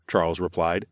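plain reading voice

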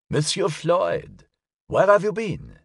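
put-on high-pitched old gentleman; curious elderly man. A narrator's reading. Elderly caller finally reaching the detective, curious old voice. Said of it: "sounded as if it belonged to an elderly man".

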